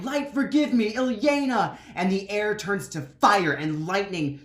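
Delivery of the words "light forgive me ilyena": high, loud desperate cry; high loud desperate shout; anguished shout. Acted. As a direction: scream it out as power overwhelms him.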